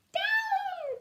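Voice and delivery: high shouted command; witch's cry. Acted. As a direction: call the broom down with a sharp cry. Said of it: "cried the witch".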